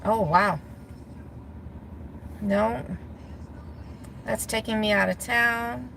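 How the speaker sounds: ordinary talk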